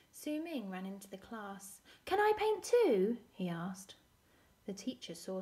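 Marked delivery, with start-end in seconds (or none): 2.1-3.2 put-on high, loud, eager child's question; eager request. Su Ming asking to join the painting class, eager. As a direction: pipe up keenly, pitched high.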